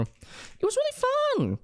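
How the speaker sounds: very high, slow and dreamy; squeaky gushing. Real, not acted. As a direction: gush about it like a starstruck fan. Gushing about movie.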